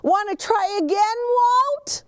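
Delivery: high, anxious little truck voice; Gus voice. A narrator's reading. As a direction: squeak it out nervously as little Gus.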